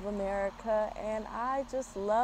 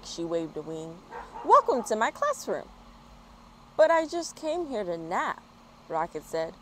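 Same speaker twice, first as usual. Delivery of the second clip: lively storybook reading voice; bright, animated picture-book narration; reading to children. A narrator's reading. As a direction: read it out like story time for little ones. Reads a children's story aloud.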